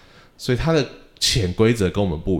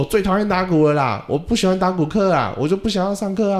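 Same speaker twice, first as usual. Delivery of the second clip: put-on much higher whining kid voice; sulky child; 10 semitones higher than usual. Student tells the teacher he hates drum class, high sulky child voice. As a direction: complain like a fed-up little boy. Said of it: "他有一次就说".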